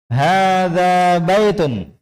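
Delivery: "Hadza baitun" chanted high and very slowly, long vowels held, falling at the end; model statement intonation. Shown as a demonstration. Teacher models it for students to repeat, drawn-out statement intonation. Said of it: "Perhatikan panjang pendeknya dan intonasinya".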